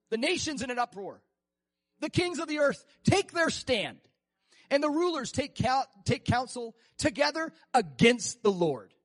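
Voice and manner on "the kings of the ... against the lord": slow, louder scripture reading; preacher building to a forceful reading; preaching. Acted. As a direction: proclaim it slowly and with weight.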